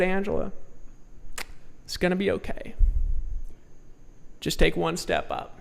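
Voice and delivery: quietly reassuring a frightened woman; gentle, hushed encouragement to a scared friend; calm her down softly, one word at a time; soft reassurance. Retold or imitated. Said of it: "and I said".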